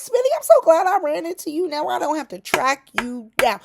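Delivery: high, loud sarcastic cheer; petty fake friendliness; fake glad greeting. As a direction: greet him with mock delight. [name]'s petty mock greeting.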